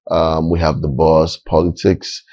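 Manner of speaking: ordinary talk, nothing marked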